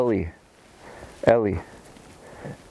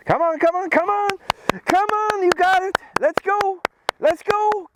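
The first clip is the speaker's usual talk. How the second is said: excited high cheering. Real, not acted. Trainer firing up his dog on the retrieve, high excited cheer. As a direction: pour gas on the fire with your voice. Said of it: "you see how my voice and my excitement".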